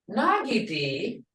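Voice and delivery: "nagidi" rising at the end like a question; "nagidi" as a curious question. Shown as a demonstration. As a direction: ask "nagidi?" with an upward lift. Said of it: "hear that tone?".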